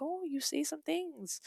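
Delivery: high, soft, slow mock interviewer; high, soft, slow prim interviewer tone. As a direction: drawl it up high and hushed. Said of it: "interview questions of like".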